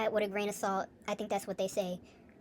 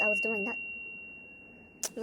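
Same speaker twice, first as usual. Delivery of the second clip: lazy confession; 5 dB quieter, much slower than usual. Real, not acted. Confessing a lazy winter break.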